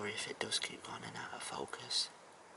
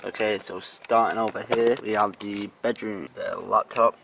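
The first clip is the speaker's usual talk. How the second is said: much louder hammy vlog host; loud presenter; 13 dB louder than usual. Acted. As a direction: boom it out like a show host. Loud house tour host.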